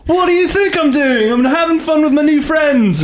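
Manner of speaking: high, laughing mock British accent. Defending himself to bandmate Nathan in a mock British accent. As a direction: ham up a posh British accent. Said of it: "I was like".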